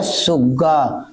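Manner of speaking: सुग्गा drawn out slowly with a long doubled g; slow word demo. Shown as a demonstration. Teacher demonstrating a word with a doubled consonant, slowly. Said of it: "क्या कहा जाए?".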